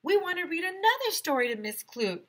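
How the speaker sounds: high pleading voice; eager kids. A narrator's reading. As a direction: beg like a whole class. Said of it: "everyone yelled".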